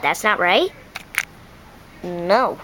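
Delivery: a chicken correcting the duck; low chicken voice. Acted. Chicken telling the duck it got it wrong, low.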